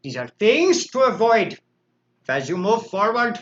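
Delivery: a put-on vaguely Indian or Russian accent, lightly done; unplaced foreign accent. Livestream host opening a recovery lesson, in a vague foreign accent. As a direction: slip into an odd foreign accent for the intro. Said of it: "I don't know where this accent is coming from".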